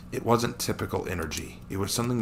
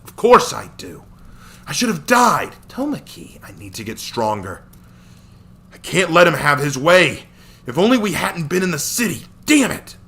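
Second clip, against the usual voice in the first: louder, higher, bitter self-blame; frustrated outburst; 9 semitones higher, 8 dB louder than usual. Acted. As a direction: rage at yourself through the words. Hero raging at his defeat.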